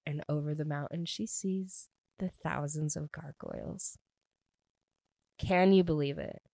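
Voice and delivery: normal talking voice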